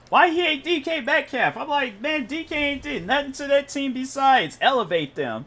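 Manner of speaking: high-pitched, incredulous protest; indignant disbelief. Real, not acted. Gamer defending D K Metcalf to his viewers, indignant disbelief. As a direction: voice jumps way up in disbelief. Disbelief at hate for favorite player.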